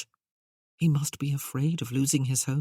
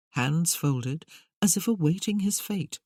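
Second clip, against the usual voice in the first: ordinary delivery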